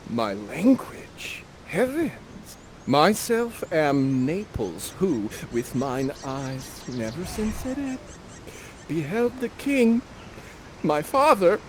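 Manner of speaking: gasp, then slow wondering declaration; amazed Ferdinand. Acted. Ferdinand telling Miranda of Naples, slow and amazed. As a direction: marvel at hearing his own tongue.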